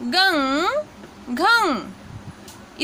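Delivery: गंग and घंग stretched very slowly, nasal ng held; slow teaching demo. Shown as a demonstration. Teacher repeating गंग घंग for students, very slow. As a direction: drag each syllable out for learners. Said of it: "इस प्रकार से इनके उच्चारण किए जाते हैं".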